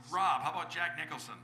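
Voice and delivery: ordinary delivery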